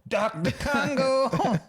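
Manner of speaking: high, slow sing-song announcement of Doctor Congo; Doctor Congo call. Acted. Friends joking, announcing Doctor Congo in a high drawn-out voice. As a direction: draw out Congo like a grand entrance.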